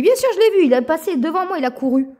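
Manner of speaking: high, loud retold insistence; insistent exclaim. Telling her colleague she saw the rat, high and loud insistence. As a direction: throw it out high and loud, certain you saw it.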